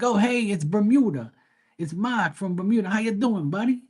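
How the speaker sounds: high-pitched Boston buddy greeting; Boston accent. Retold or imitated. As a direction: mimic a Boston friend hailing a pal. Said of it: "when my Boston friends see me".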